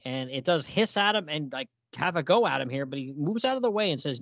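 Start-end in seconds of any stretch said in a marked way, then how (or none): none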